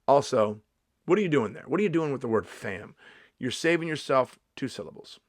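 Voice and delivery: ordinary talk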